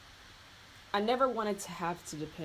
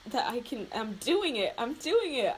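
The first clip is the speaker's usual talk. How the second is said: excited; 7 semitones higher than usual. Retold or imitated. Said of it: "I was like".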